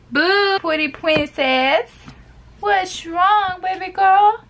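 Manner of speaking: high, slow pet-talk voice. Real, not acted. Talking to her pet.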